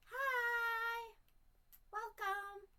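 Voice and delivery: very high, loud welcome voice; cheery character greeting. Acted. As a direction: greet like a peppy game host. In-game character greeting, put on.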